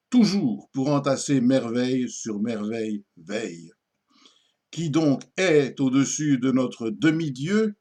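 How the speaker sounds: declaimed verse with echoed end words; poem recitation. Acted. Presenter recites a poem in praise of Louis XIV, declaimed. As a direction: recite it grandly, pausing before each echoed rhyme.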